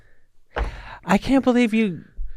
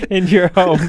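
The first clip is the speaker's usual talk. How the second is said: words broken up by real laughter; laughing while talking; 4 dB louder than usual. Real, not acted. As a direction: finish the thought through giggles. Cracking up at a remembered bit.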